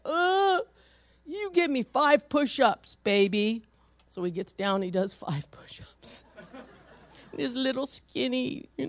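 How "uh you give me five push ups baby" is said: stern dad. Retold or imitated. Dad scolding the child.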